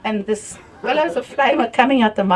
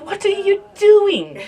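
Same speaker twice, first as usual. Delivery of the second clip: exasperated question; 10 semitones higher, 4 dB louder, slower than usual. Retold or imitated. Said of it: "I found myself".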